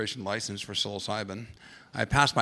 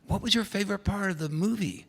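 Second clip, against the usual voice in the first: put-on higher, sing-song question to a kid; talking to a child; 9 semitones higher than usual. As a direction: ask it brightly like to a small child. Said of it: "And I go".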